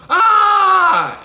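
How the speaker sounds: a huge high shout into the canyon; scream. Shown as a demonstration. Showing a scream at the Grand Canyon edge, loud high shout. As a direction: yell it out over the edge. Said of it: "scream and go".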